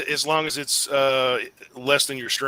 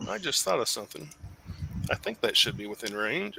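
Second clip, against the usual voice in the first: normal talking voice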